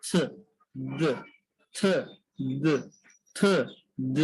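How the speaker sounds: থ as unvoiced th, দ as voiced th, tongue on the gum ridge; th sounds demo. Shown as a demonstration. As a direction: alternate থ and দ as th sounds. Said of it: "উচ্চারণটা হবে". (জিহ্বা মাড়িতে রেখে থ, দ উচ্চারণ)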